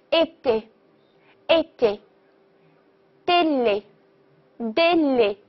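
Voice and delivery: "été，été，délai，délai" very slowly, closed ay; small-mouth é. Shown as a demonstration. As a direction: model "été，été，délai，délai" word by word, narrow vowels. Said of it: "发A小口".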